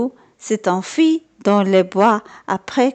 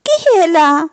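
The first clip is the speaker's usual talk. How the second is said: put-on higher, louder call of an old lady through the door; grandmother asking; 7 semitones higher, 5 dB louder than usual. A narrator's reading. Grandmother calling through the door, asking who it is. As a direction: call out to ask who knocks. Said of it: "se demande grand-mère".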